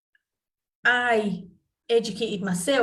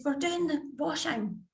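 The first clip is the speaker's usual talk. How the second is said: lightly voiced small son, raised pitch; a hint of a little boy, higher; young son; 5 semitones higher, 4 dB quieter than usual. Son explaining his thanks to mum, in a lightly imitated higher voice. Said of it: "And he said".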